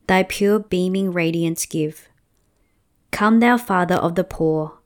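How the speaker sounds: ordinary talk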